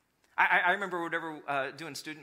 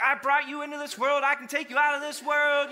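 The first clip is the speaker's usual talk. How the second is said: higher, louder stern threat; mock fed-up mom; 8 semitones higher, 7 dB louder than usual. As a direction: warn the kids like an exasperated mother. Fed-up mom to fighting kids.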